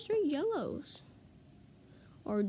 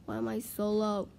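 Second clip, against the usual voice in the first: ordinary delivery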